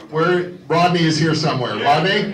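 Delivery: loud call across room. Real, not acted. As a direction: call out over the crowd. Calling out to the room.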